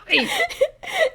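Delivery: shrill distant giggle; high eerie cackle. Acted. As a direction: cackle like an unseen spirit. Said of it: "You then hear a louder laugh".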